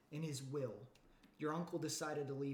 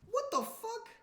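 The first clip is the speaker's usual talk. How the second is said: much higher, loud shocked squeal; shocked yelp; 6 dB louder than usual. Real, not acted. Streamer shrieks when the page fails, high shocked squeal. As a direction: shriek in disbelief.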